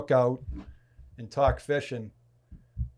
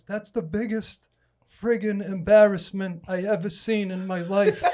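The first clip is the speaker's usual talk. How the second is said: mocking imitation of Nate; 8 semitones higher, 6 dB louder than usual. Imitating Nate scoffing at the young man's rig. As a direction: sneer at the rig like an old pro.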